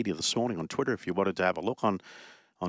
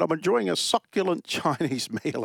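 laughing mock posh, pitched high; hammed-up plummy gentleman; 7 semitones higher than usual. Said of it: "he had a very posh voice".

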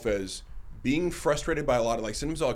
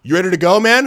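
much louder and higher, calling out to hurry him; pushy staff guy; 7 semitones higher, 12 dB louder than usual. Retold or imitated. Desk guy calls out to hurry him out of the sauna, loud. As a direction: call it out to rush him out the door. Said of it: "he's like".

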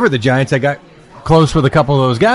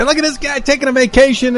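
mocking; 11 semitones higher than usual. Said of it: "nobody wanted to get out and say".

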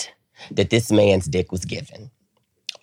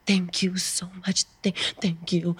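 high, hushed whimpery thanks; acted gratitude; 10 semitones higher, 6 dB quieter than usual. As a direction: whisper it as if overcome, hammed up. Acted tearful gratitude to the man.

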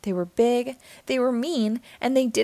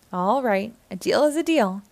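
ordinary delivery

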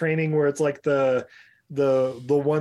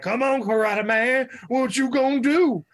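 put-on higher, taunting drunk voice; 9 semitones higher than usual. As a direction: goad him like a barfly.

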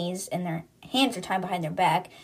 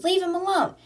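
high-pitched mock cry of protest; imitated yell, much higher; retold protest; 10 semitones higher than usual. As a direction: call out in defence, voice raised in pitch. Captive protesting amid retold yelling.

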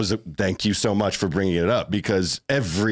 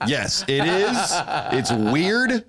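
ordinary talk

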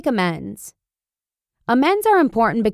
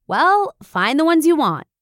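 raised, higher call across the house; mild mock yell; 6 semitones higher than usual. Mom yelling back to her son from the kitchen, mild mock yell. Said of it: "I yell from the kitchen".

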